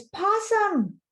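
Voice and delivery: high, loud drawn-out call; storybook shout of alarm; warning call. A narrator's reading. Bear calls a warning to Possum.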